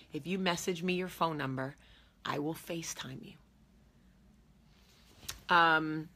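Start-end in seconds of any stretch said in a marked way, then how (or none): 2.2-3.3 soft whispered promise; whispering. Real, not acted. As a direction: breathe it as a whisper. Promise repeated, dropped to whisper.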